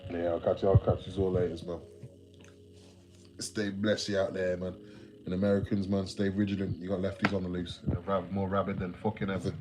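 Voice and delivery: usual speaking voice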